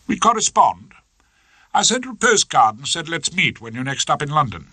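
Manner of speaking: a hint of a haughty wife; wife announcing plans, cool; Hilda voice.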